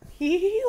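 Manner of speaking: high, imitated girlish "hee hee"; light, high-pitched mock giggle; happy little girl giggle. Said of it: "like a happy little girl giggle".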